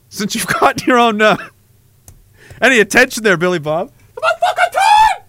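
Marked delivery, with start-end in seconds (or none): 4.2-5.2 shrill high screaming voice; insane high-pitched scream. Acted. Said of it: "scream at us in this insane, high-pitched".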